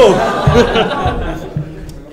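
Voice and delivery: a quick amused laugh at the crowd; short laugh after teasing the crowd. Real, not acted. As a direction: break into a laugh. Laughs at the crowd mid-battle.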